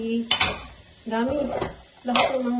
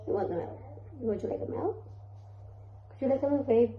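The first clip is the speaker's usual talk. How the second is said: hushed, gentle question; lowered, cooing voice; 2 semitones higher, 7 dB quieter, slower than usual. Real, not acted. Mother softly asks baby.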